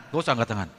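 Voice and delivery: ordinary talk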